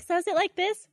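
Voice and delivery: high, innocent question; naive girl. Pretend. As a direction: play helpless. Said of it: "I'll be like".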